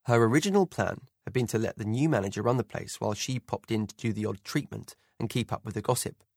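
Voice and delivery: ordinary delivery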